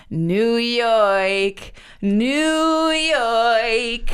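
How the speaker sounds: hammed-up old-time New York accent, sung out long; sung "New Yoik" in a mock New York accent. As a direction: belt it drawn out in thick New York-ese. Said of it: "with my New York accent".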